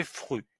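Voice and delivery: fru with a rounded u; syllable fru. Shown as a demonstration. Teacher giving fru as an example. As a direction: say fru clearly. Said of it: "F R avec un U, ça fait".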